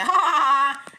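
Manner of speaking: loud mocking ha ha ha; villain laugh. Plant laughing at the bear. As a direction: cackle like a villain.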